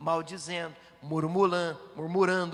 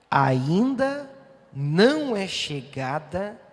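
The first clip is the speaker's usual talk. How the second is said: congregational reading aloud; 10 dB louder, much slower than usual. Acted. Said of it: "vamos ler essa frase juntos".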